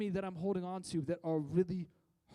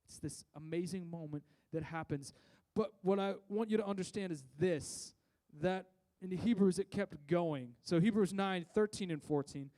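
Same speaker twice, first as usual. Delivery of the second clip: ordinary delivery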